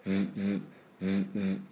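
soft voiced ズ buzz. Shown as a demonstration. Teacher demonstrating the v vibration. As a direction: buzz ズ through lip and teeth. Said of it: "これが振動しまして".

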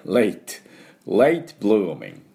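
"late blooming" slowly, late as one syllable layt; English pronunciation model. Shown as a demonstration. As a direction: stretch the vowels of "late blooming". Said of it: "ラテじゃないわ".

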